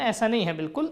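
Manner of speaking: ordinary talk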